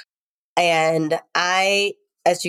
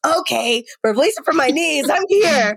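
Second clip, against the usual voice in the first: higher, eager retold child's voice; fully into it; 7 semitones higher than usual. Mother imitating her son joining the anger exercise, eagerly. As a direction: imitate a kid throwing himself into the game. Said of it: "fully in it".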